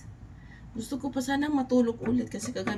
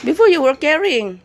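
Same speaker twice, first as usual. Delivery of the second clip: louder, higher accusing retort; 6 semitones higher, 15 dB louder than usual. Real, not acted. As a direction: call him out on it. Arguing with husband about wallet. (malakas na pagsumbat)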